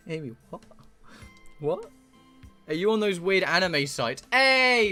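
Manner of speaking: ordinary talk, nothing marked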